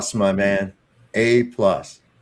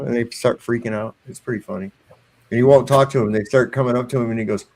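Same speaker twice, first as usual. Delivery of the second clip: normal talking voice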